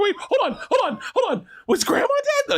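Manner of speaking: very high, squealing child imitation; hammed-up kids in alarm; mock panicked girls. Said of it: "Girls go".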